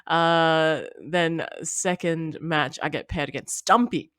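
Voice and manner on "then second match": normal talking voice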